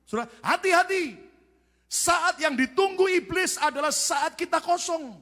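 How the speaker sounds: high, loud, urgent warning; full-force preaching. Real, not acted. Preacher warning the congregation about the devil, full-force. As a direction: hammer it out slowly to the crowd.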